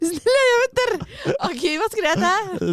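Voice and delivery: high-pitched, laughing through words. Real, not acted. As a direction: giggle while asking. (skrattande, ljus röst)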